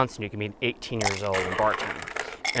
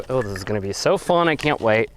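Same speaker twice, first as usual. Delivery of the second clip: usual speaking voice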